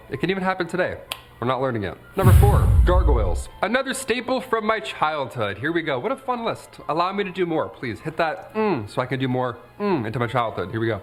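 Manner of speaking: usual speaking voice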